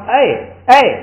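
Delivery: 哎 called out, rising then falling, a peak in the middle; calling rise-fall. Shown as a demonstration. Teacher calling out to show a mid-high tone. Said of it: "典型的中间高".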